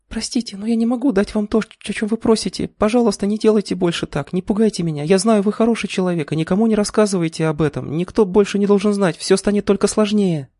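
high, pleading female voice; plaintive young woman. A narrator's reading. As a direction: beg him, clinging and lamenting. Клара причитает, прижавшись к груди. (жалобный высокий женский голос)

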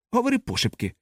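high-pitched, sharp hushed order; a curt, secretive command. A narrator's reading. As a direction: order it curtly, keeping the volume down. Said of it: "сказав він різко".

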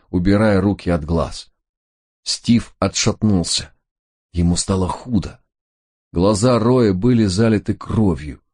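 ordinary delivery, nothing marked

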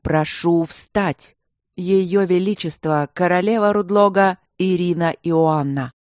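slow, ceremonial herald's call; stately, drawn-out public proclamation; formal announcement. A narrator's reading. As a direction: announce royalty to a full hall. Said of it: "объявил в микрофон".

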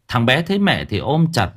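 plain reading voice

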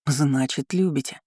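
ordinary delivery

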